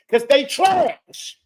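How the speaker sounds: slow, loud, angry shout; furious slow shout. Real, not acted. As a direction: spit it out at her slowly. Angry rant at host.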